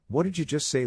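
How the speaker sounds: sharp high shout of outrage; a sudden rising shout. A narrator's reading. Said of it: "He shouted".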